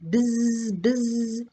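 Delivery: a little buzz like a bumblebee; buzzing bee noise. A narrator's reading. Boy mocking Aunt Bee with a bee buzz.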